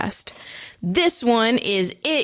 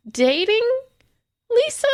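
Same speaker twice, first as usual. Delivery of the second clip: "Lisa" lifted high like an uncertain question; questioning rise. Shown as a demonstration. Host unsure who Jack starts dating, questioning rise on "Lisa". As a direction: let "Lisa" climb into a doubtful question. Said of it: "Question mark?".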